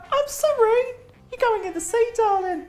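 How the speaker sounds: high-pitched mock parent laying down the law; exasperated parent retort. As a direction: squeal it high and insistent, as a put-on parent. Acts out paying parent's retort.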